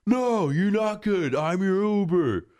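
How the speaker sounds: hammed-up bossy yell at the group; mock yelling. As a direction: driver yelling at the friends, mock high. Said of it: "He's just, like, yelling at us".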